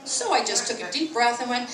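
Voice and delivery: ordinary talk, nothing marked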